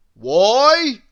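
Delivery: noisy character shouting; booming Mister Noisy. Acted. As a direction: blast the word out. Mister Noisy voiced in story.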